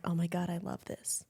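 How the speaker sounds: breathy whispered quote; whispering. Retold or imitated. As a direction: hush it to a whisper.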